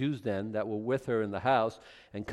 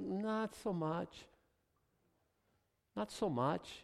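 soft, drawn-out correction; understated, slow rebuttal after bluster; quiet put-down; 7 semitones higher, 7 dB quieter, much slower than usual. Acted. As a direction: let the boast down gently. God deflates the man's loud boast.

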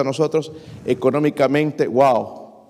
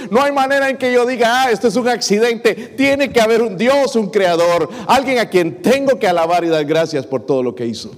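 fervent preaching; 8 semitones higher, 3 dB louder than usual. Acted. Preaching with rising fervor.

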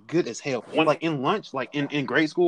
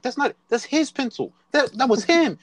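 protesting; 9 semitones higher, 3 dB louder, slower than usual. Retold or imitated. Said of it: "I'm like".

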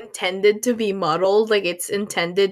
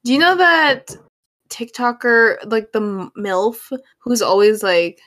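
usual speaking voice

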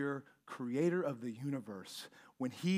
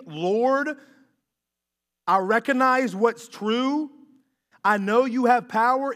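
pleading plea; 8 semitones higher, 11 dB louder, slower than usual. Acted. Preacher voices Martha's plea.

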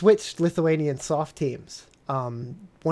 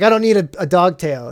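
louder retold brag; 3 semitones higher, 7 dB louder than usual. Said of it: "he's like".

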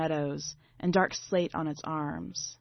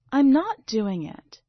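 defensive outburst; 6 semitones higher, 8 dB louder, slower than usual. Acted. Narrator defends herself.

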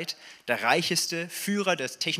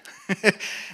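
short incredulous laugh; 6 semitones higher than usual. Real, not acted. Amused by the odd question.

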